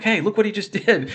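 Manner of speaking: high and laughing with joy; excited, laughing. Retold or imitated. To everyone around, a high laughing announcement. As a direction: call out the news through a laugh. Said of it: "go around yelling to everyone".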